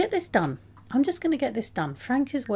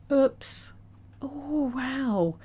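ordinary talk, nothing marked

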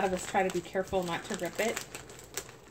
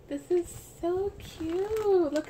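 delighted; 11 semitones higher, 6 dB louder, slower than usual. Real, not acted. Delighted by a baby card.

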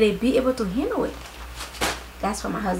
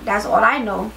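normal talking voice

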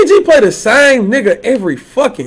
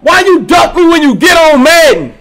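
higher, louder call-out; 5 semitones higher, 3 dB louder than usual. Real, not acted. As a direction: demand an answer across the room. Calling out opponent on stream.

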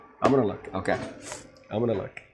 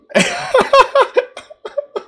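much higher and louder laughing fit; shrieking laugh; 10 dB louder than usual. Real, not acted. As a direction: laugh helplessly at the surprise. Keeps laughing in disbelief.

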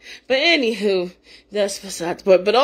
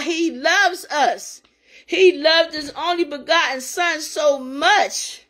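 slow emphatic; 5 semitones higher, slower than usual. Real, not acted. Preaching God's love.

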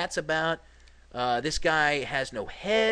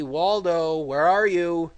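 louder, calling across a distance; 7 dB louder, slower than usual. Pretend. Gamer calling out to hidden Waldo, mock calling. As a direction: call for him like he's lost.

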